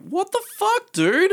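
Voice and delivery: high, loud disbelieving yell; shocked shout. Retold or imitated. Shocked reaction to two noses.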